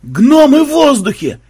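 high, loud, stretched-out shout; stunned full-voiced holler; shocked yell. A narrator's reading. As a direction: raise the alarm in utter astonishment. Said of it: "потрясённо заорал другой тамплиер".

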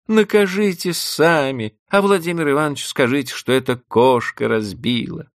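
pleading. Acted. Vanda begging again, high plea. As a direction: beg for mercy.

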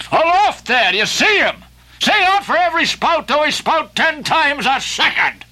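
a high, loud hail to the mastheads; calling aloft. Acted. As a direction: call up to the men in the rigging. Ahab calls to the lookouts.

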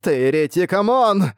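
high, loud cry of terror; terrified cry. A narrator's reading. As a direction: scream the accusation in horror. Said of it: "переполненный ужасом голос".

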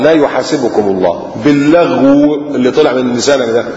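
ordinary talk

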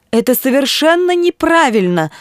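high-pitched protesting bishop; indignant exclamation. A narrator's reading. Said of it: "воскликнул он". (возмущённое восклицание)

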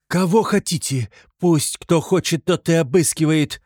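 high, loud defiant cry; shouting. A narrator's reading. Katerina challenging anyone to search Sonya, shouting. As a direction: fling the challenge out loudly. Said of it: "кричала Катерина Ивановна".

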